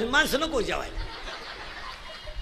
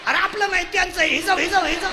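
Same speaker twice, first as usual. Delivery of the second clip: high, loud urgent cries; mock panic; 7 semitones higher, 5 dB louder than usual. Supporters realise the result is theirs, mock panic. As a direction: call it off in a comic fluster. Acts panicked call to put out.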